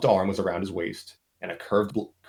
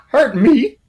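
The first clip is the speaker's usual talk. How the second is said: high, much louder cackling laugh into words; mocking laugh; 10 dB louder than usual. The captor laughing off Engineer's threat, loud mocking cackle. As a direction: laugh in their face.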